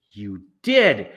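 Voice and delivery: loud final verdict; insisting. Acted. Amanda insists to Mars Bar, loud final verdict. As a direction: slam it down.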